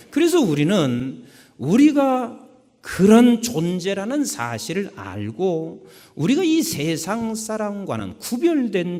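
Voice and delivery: fervent sermon build; preaching. Acted. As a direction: press the point home to the congregation.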